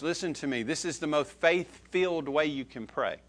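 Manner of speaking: usual speaking voice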